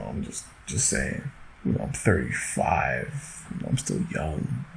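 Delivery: soft unvoiced trailing words; whispering. Real, not acted. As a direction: drop to a near whisper. Trailing whisper about age.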